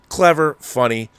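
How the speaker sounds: ordinary talk, nothing marked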